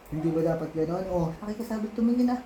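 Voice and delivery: ordinary talk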